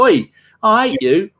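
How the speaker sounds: mock heckle. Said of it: "and go".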